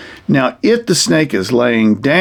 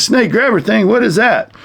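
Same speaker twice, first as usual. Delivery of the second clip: high-pitched, incredulous puppet questioning; puzzled snake puppet; 8 semitones higher than usual. Pretend. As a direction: play a baffled snake, voice pitched up. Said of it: "The snake says".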